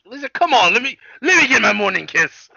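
high, loud pleading for a morning kiss; pleading O'Brien. Retold or imitated. O'Brien begs his daughter for a morning kiss, high and loud. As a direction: whine it up high like a begging husband. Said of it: "and he's like".

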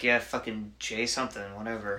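low, quiet brush-off as a careless barista; dismissive barista. Retold or imitated. Starbucks worker fobbing off a customer's name, dismissive. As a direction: imitate staff who can't be bothered. Acting out indifferent Starbucks staff.

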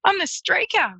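high, pleased I'm the streaker; retold proud reveal. Tradie revealing he's the streaker, high and chuffed. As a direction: announce it chirpily, proud of himself. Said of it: "And he goes".

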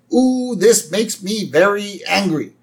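high, slow cartoon alien voice; Marvin the Martian. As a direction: put on a high, deliberate cartoon grievance. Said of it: "Marvin the Martian".

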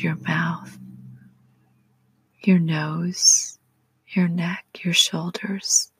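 slow, soothing meditation whisper; soft spa whisper. Acted. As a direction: guide them to relax, whispering. Leads a relaxation prayer.

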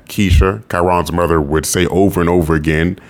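usual speaking voice

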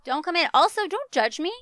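ordinary talk, nothing marked

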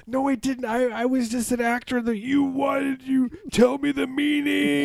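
high, strained acted plea; mock movie interrogation; pleading character.

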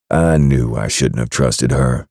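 plain reading voice

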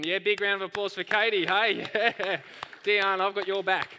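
loud call for applause over the room; rally the room to clap. Real, not acted. Pastor calling the crowd to cheer for Katie.

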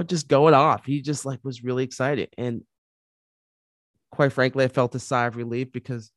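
ordinary talk

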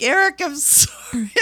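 high, loud apology through laughter; laughing apology. Retold or imitated. Apologizing to Eric after the police came, laughing. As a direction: apologize while cracking up. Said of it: "I'm like".

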